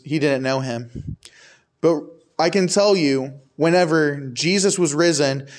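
ordinary talk

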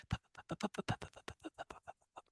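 unvoiced pops fading away; soft popping noises. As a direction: mimic rain dripping on a tin roof, softly. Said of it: "I could just hear, like".

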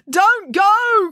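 loud, high, drawn-out cry. Acted. Binky to departing Friday, crying out. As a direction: wail it out to make them stay. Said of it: "cried Binky".

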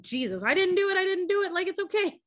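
high, panicky pleading; mock freaked out. Retelling Stevie fearing his brother will kill him, panicked. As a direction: imitate a scared kid protesting innocence. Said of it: "freaked the fuck out".

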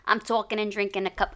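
put-on light New York accent; New York City accent. [name] copies the host's New York accent. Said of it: "New York City".

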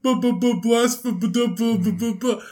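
high teasing stammer; mock stutter. Friend mocking the flubbed word with a stammer. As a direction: sputter the b like a jammed record. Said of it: "mocking stutter".

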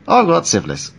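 high, loud, chipper British comedian; upbeat British accent. Retold or imitated. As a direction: announce it cheerfully in a put-on British accent. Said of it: "it can make things sound upbeat".

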